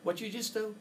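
imitating the beaten inventor's amazement; stunned disbelief. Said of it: "Al would lean forward and go".

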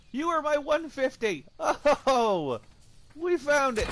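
excited shout. Real, not acted. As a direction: yell it out like you struck gold. Finds a level 150 dino.

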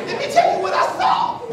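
put-on high-pitched excited child; excited altar boy. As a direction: burst in high, eager to report. Breathless altar server's news.

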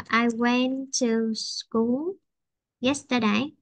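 slow, drawn-out teaching model; slow example sentence. Shown as a demonstration. Teacher showing the past tense to a student, slow. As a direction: stretch each word for the learner.

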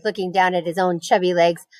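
plain reading voice

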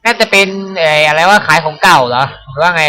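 ordinary talk, nothing marked

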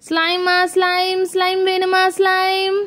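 slow, drawn-out hawker's singsong; street vendor's call. Acted. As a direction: stretch each word like a hawker. Slime seller calls out wares. (தெரு வியாபாரி கூவும் குரல்)

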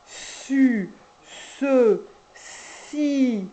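"Su, se, si" with the s held long; stretched syllables. Shown as a demonstration. Teacher showing syllables, holding each s long. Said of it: "même chose avec les autres syllabes".

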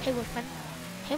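ordinary talk

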